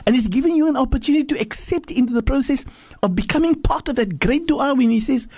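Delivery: high-pitched, emphatic preacher; impassioned preaching. Acted. Preacher exhorting pilgrims.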